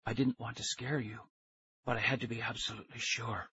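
very soft, whispery man's voice; thin whisper. A narrator's reading. Stranger reassuring captured kids, thin whisper. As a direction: speak in a thin, hushed voice. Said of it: "He had a thin whisper of a voice".

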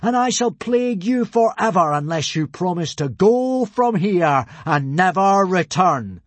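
high, loud godly proclamation; thunderous ultimatum; booming threat. A narrator's reading. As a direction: lay down the curse grandly, slowing at the end. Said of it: "boomed Hiccup solemnly".

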